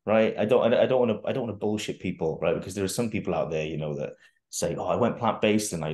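normal talking voice

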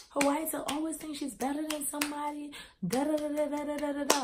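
high, put-on rant voice; mock going off. Friend goes off in a disagreement, high mocking imitation. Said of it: "Like, just going off".

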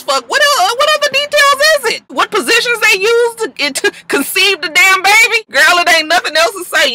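high-pitched, exasperated mock disbelief; incredulous rant. Real, not acted. Gossip host mocks Rashida withholding details, incredulous rant. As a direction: pitch it way up, demanding to know.

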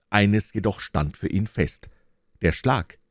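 usual speaking voice

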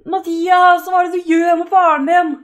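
loud shocked scolding, pitch up; outraged loud call; shouting at character. Real, not acted. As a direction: yell at him in disbelief.

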